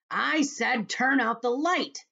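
loud, high, slow furious shout; angry shout. Acted. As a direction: yell it in angry frustration. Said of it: "shouted the centipede angrily".

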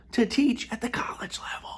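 hushed, awed whisper; reverent whisper. Acted. Comic host whispering the title to teachers. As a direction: breathe the word doctor.

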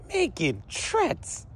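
high, drawn-out disbelief; stretched-out incredulous question; incredulous echo. Retold or imitated. Incredulous echo of officer's accusation.

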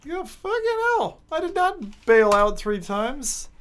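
high drawn-out exasperation; outraged disbelief. Real, not acted. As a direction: drag it out in outrage. Outraged at ranking.

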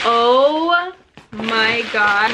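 stretched out, slow and amazed; awed. Real, not acted. Reading her best friend's card, slow awed gasp. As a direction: draw it out in disbelief. Opening friend's gift package.

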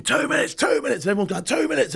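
high shouted warning over engine noise; retold loadies' shout. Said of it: "the loadies screaming".

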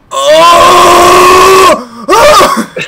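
loud, high screaming Oh; operatic scream. Retold or imitated. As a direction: belt out the screaming Oh. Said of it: "the guy going".

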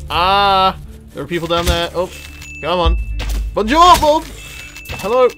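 ordinary talk

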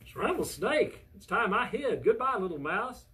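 high, startled yelp of a reading; alarmed snake. Acted. Snake hears he is the favorite food, alarmed. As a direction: exclaim it in storybook panic. Frightened snake flees on hearing it.

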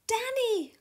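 high-pitched call to a friend. Acted. Sam calls up to the warbler, high and urgent. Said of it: "called Sam".

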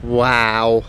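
dry, unimpressed wow; deadpan, badly acted awe; sarcastic wow. Real, not acted. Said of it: "That was a much more sarcastic wow than I was going for".

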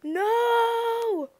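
high, loud wail; despairing shout. Real, not acted. Gamer losing her unicorn, loud high scream of no. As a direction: cry out in despair. Pet falls or is lost.

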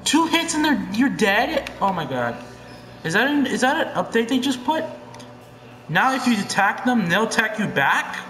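can't believe the game just did that; shocked disbelief. Real, not acted. Golems die in two hits, shocked high disbelief.

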